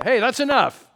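loud, high scolding yell; exasperated parent shout. Acted. Parent stops an arguing child, shouting sharply. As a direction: cut the kid off with a shout.

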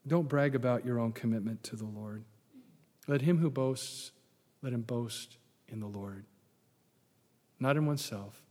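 ordinary delivery

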